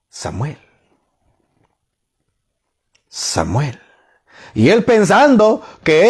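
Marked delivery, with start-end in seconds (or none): 0.1-0.6 very soft, low call in the night; voice calling Samuel. Acted. God calls the boy Samuel at night, hushed. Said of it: "una voz que le decía". 3.1-3.8 hushed, low night-time call; voice calling Samuel. Acted. God calls young Samuel once more, soft and low. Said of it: "una voz que le decía".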